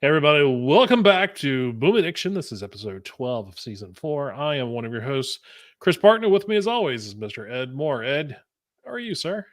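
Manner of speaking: upbeat show opener; podcast host intro. Real, not acted. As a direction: open the show with energy. Show opening host read.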